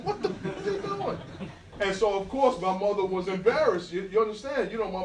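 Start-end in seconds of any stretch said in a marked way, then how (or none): 0.0-1.2 much higher, half-laughing disbelief; shocked neighbors. Retold or imitated. Neighbors watching him run the tracks, high shocked disbelief. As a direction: gasp it out like a stunned onlooker. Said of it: "all the people from my building is like".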